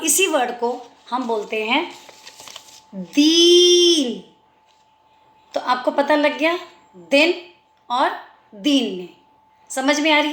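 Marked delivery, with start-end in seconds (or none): 2.9-4.2 "दीन" with the ee drawn out very long; sustained long ee in "दीन". Shown as a demonstration. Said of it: "तो बड़ी आवाज़". 7.1-7.3 "दिन" said with a short i; quick, short i in "दिन". Shown as a demonstration. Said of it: "दिन मतलब छोटी".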